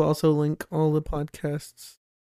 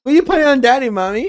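put-on child voice; 8 semitones higher, 11 dB louder, faster than usual. Kid voice imitating child asking mommy.

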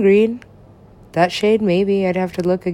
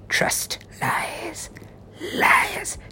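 hushed, unvoiced, spooky word list; ominous whisper; 9 semitones higher, 8 dB quieter, much slower than usual. Listing dark themes of the music in a hushed whisper. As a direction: breathe each word like a dark secret.

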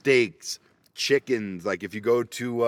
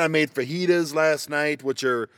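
ordinary talk

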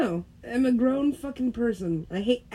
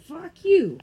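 higher, louder Fuck you; mock shout; 10 semitones higher, 9 dB louder than usual. Teasing a friend, playful shout. Said twice, quiet then loud.

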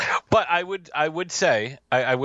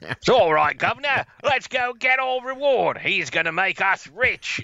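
hammed-up high Cockney, much higher than his own voice; mock Cockney accent; 8 semitones higher than usual. Mocking the dubbed villagers' Cockney to his co-host, hammed-up Cockney. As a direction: lay on a silly movie-dub Cockney accent. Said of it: "goddamn Cockney accents".

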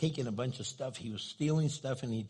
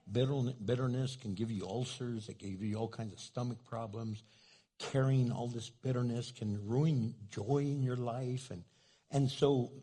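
usual speaking voice

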